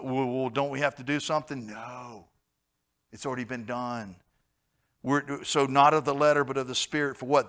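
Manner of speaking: ordinary talk, nothing marked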